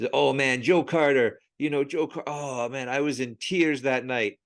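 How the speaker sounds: excited Philly cab driver voice; loud, wistful Philadelphia fan; Philly accent. Retold or imitated. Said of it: "he goes".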